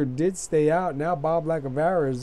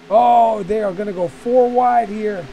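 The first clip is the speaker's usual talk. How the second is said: loud, high excited call; excited shout; 7 semitones higher, 10 dB louder than usual. Real, not acted. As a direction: call it like the crowd's on its feet. Excited race call, crash risk.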